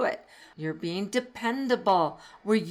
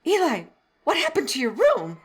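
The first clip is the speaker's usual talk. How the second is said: higher, louder mock dismay with a laugh; laughing mock surprise at a messy room; mock shock; 8 semitones higher, 6 dB louder than usual. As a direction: playfully scold a grandchild. Grandma teasing grandson on FaceTime.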